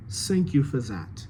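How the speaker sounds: "Thank you for that" as "sank you for zat"; French TH, S and Z sounds; French accent. Shown as a demonstration. Coach models the ambassador's line, French accent.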